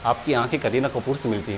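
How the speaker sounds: butter her up softly to get the order; mock sweet flattery. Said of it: "अभी तो प्यार से बात कर रहा हूँ". (बहुत धीमी, मीठी चापलूसी भरी आवाज़ में)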